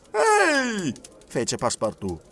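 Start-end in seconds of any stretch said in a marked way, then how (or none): 0.1-0.9 very high, startled yelp; indignant cry. A narrator's reading. Servant shocked by the detective's proposal, crying out. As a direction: cry out in protest.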